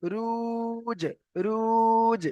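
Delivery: রুজ with a long-held u and bounced j; long vowel, qalqalah. Shown as a demonstration. Teacher joins the letters for students, drawn-out রুজ with echoed j. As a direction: stretch the u in রুজ, then release the j with an echo. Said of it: "কলকলা করতে হবে".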